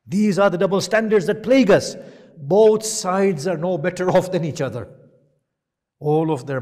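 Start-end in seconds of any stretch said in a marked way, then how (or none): 0.1-4.9 much higher, loud impassioned preaching; preacher at the height of his fervour; preaching at full force. Acted. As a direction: thunder it at the congregation.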